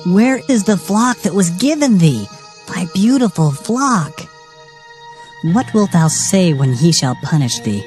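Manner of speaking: ordinary talk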